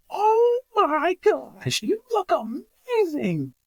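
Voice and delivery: high, drawn-out delighted gush; amazed gushing. Retold or imitated. Hospital worker to returning patient, amazed gushing. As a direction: stretch it out in high-pitched amazement. Staff member amazed at seeing him.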